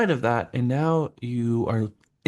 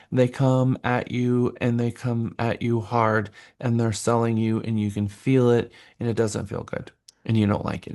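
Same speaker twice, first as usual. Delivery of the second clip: ordinary delivery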